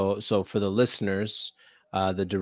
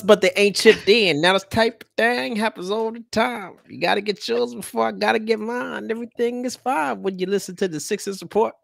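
[name] twang; 12 semitones higher, 5 dB louder than usual. Acted. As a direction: lay on the laid-back West Coast swagger. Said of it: "the twang in your voice in the intro".

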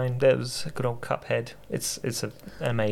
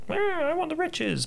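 greedy little voice, pitched way up; high eager cartoon voice. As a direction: grab for the riches eagerly. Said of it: "he's like".

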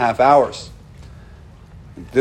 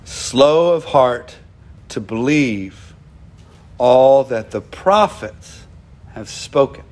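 slow solemn reading; 3 semitones lower, slower than usual. Retold or imitated. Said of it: "he says".

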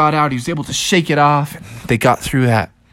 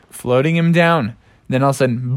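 plain reading voice

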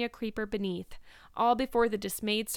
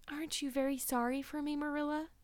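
put-on soft, high, pitiful girl's voice; plaintive; 6 semitones higher, 5 dB quieter than usual. A narrator's reading. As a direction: beg for sympathy from a sickbed. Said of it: "a plaintive voice greeted her".

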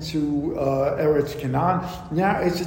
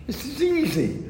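much higher, light sarcastic voice; mock reassurance; 8 semitones higher than usual. Teacher voicing idol promoters luring followers, sarcastic. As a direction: sell it as effortless, with irony. Voicing the idolaters' easy offer.

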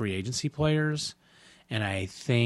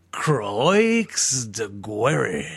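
put-on Croix de Guerre said crisply in a French accent; proper French accent; much slower than usual. Host's third take finally pleasing co-host, French accent. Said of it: "Nailed it".

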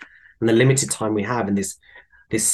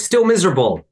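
higher, louder sour retort; grumpy lady; 7 semitones higher, 5 dB louder than usual. Retold or imitated. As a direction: grumble it proudly, lightly voiced. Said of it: "she goes".

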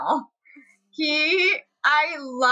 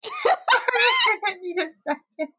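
laughing; 9 semitones higher, much slower than usual. Real, not acted. Overcome by laughter at question.

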